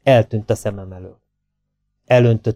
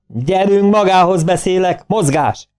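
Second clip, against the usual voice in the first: magas hangú, hajszoló kiáltás; belekiabálni a segéd arcába, mérsékelt hangerővel; bömbölés; 8 semitones higher than usual. A narrator's reading. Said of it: "belebömbölt Vörös arcába". (felolvasott, parancsoló bömbölés)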